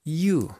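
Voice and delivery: YOU with the y said like a preppy i; YOU glided from an ee into oo; gomelo-style you. Shown as a demonstration. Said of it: "Busquen su gomelo interior y digan esto".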